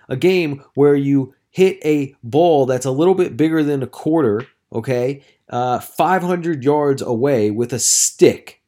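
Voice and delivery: acted-out heated telling-off; exasperated lecture. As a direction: hammer each point home to the complainer. Retold lecture to negative golfer.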